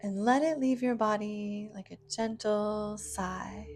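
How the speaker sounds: normal talking voice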